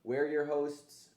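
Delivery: ordinary talk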